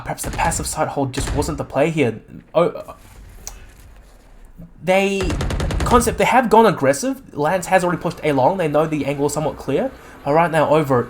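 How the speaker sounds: normal talking voice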